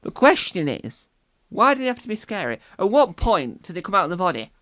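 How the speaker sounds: normal talking voice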